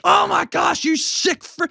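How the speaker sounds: high, horrified mock gasp; mock judgmental shock. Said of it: "You can't be like".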